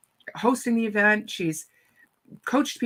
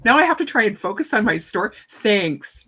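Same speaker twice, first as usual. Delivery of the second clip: mock exasperation; 3 semitones higher, 9 dB louder than usual. Speaker retelling being called on stage, flustered and exasperated. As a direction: protest in a comic fluster.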